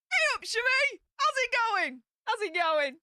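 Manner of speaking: ordinary talk, nothing marked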